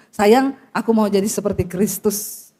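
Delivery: low, slow endearment; tender husband. Shown as a demonstration. Modelling what husbands should tell wives, low and tender. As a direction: say it low and unhurried, as to a beloved wife. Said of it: "Coba bilang sama istrimu".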